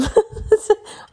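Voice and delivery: high, loud bubbling laugh; embarrassed giggle. Real, not acted. Laughing after apologising for yawning.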